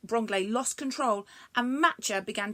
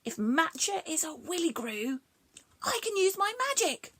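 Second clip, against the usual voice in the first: excited realisation; 7 semitones higher than usual. Acted. Bronglae realising.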